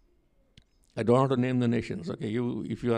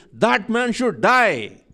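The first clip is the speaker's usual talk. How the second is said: loud, slow, furious pronouncement; furious verdict; 7 semitones higher, 7 dB louder, much slower than usual. Retold or imitated. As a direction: thunder out the death sentence like an enraged king. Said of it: "furious. He rises up and says".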